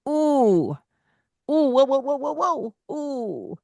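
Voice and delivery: high, breathy startled whoas; startled child. Acted. Parent acting a toddler's fright at the fan. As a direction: act out a child getting a fright.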